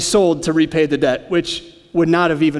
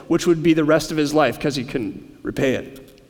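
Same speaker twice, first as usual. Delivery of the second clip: ordinary talk, nothing marked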